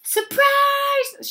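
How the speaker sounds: very high, loud squeal of a shout; excited shout. A narrator's reading. Maid echoes the ladies' party greeting, shouting. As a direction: shout it gleefully, right up high. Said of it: "shouted Amelia Bedelia".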